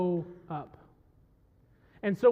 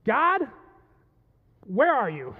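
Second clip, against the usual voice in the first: raised, demanding cry; 4 dB louder, slower than usual. Acted. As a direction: call out urgently for an absent God. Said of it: "then his tone changes".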